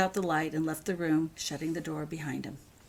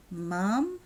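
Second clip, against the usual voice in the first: plain reading voice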